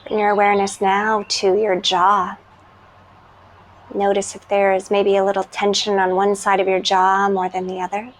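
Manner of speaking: calm, unhurried meditation voice with long pauses; guided meditation. Real, not acted.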